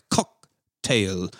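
"cock" popped out, then a gap before "tail"; stress on cock. Retold or imitated. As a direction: break "cocktail" in two, weight on "cock". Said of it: "at some point he was like".

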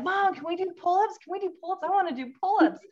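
put-on high eager child begging; eager kid voice. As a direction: beg like an excited little kid. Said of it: "they were out here like".

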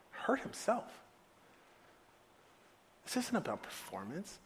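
very soft, near-whispered appeal; quiet fatherly earnestness, long pauses; hushed explanation. Acted. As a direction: explain it gently, almost under your breath. Father gently explains to hurt son.

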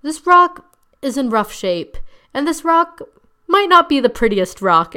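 put-on solemn sincerity, pausing for effect; mock dramatic speech. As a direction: imitate an overly earnest girl making a speech. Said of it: "And she goes".

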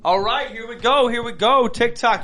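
high, loud hype opener; excited kickoff. Real, not acted. Host opening the TikTok live game segment, excited. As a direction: launch the show with a high, loud call.

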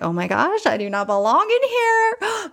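high, stretched-out wail of self-doubt; hammed-up imposter panic; mock panic. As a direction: playfully freak out, voice climbing high. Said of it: "I had imposter syndrome like you would not believe".

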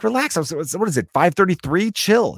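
lightly voiced bratty kid saying chill; cocky kid. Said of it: "that's my ten-year-old response".

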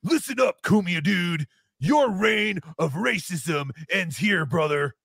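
slow, drawn-out heel taunt; wrestling promo. Retold or imitated. Host reads a super chat as a wrestler promo, mock growl.

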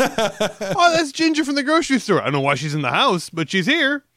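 high, loud put-on voice of an unbothered resident; breezy denial. Resident excusing a strange woman in the house, breezy denial. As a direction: explain the ghost away in a high, loud voice.

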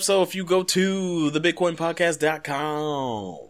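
slow, high DJ hype voice; roller rink DJ. Acted. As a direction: announce it like a rink DJ. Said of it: "I wanna talk like a roller coaster rink DJ".

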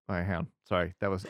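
usual speaking voice

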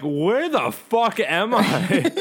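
high, drawn-out disbelief with laughter; hammed-up incredulous question; mock bafflement. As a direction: retell it as bewildered, slow and laughing. Retelling bafflement at the thick accent.

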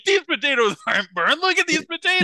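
high, loud outraged protest; indignant shouting. Retold or imitated. Retelling arguing with the firefighter, high indignant yell. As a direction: defend the potatoes, furious. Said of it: "I was like".

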